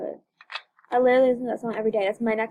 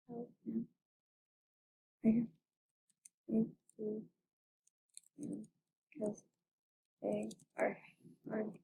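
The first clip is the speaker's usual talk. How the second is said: quiet; 4 semitones lower, 16 dB quieter than usual. Real, not acted. Whispered asides.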